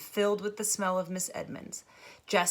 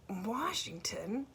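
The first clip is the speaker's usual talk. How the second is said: sleepy whisper; 4 semitones higher, 6 dB quieter, much slower than usual. Acted. Sleepy mother mumbling.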